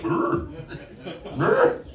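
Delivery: short imitated bear growls, jumping high. Imitates the meth bear's growl.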